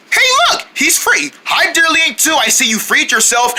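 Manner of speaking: ordinary delivery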